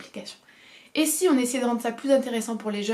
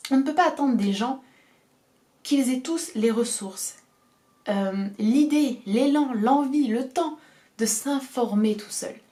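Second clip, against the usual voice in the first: énumération lente; 3 semitones higher, slower than usual. Real, not acted.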